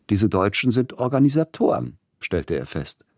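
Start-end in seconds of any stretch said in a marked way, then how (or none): 0.1-2.0 higher pitch, amused approval; note it with amused admiration; amused, appreciative. A narrator's reading. Tchaikovsky remarks on his German hosts, amused and appreciative. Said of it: "belustigt und anerkennend".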